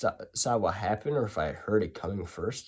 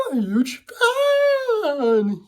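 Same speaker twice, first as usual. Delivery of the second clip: dopey fan voice; 7 dB louder, slower than usual. Retold or imitated. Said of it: "he pretty much says".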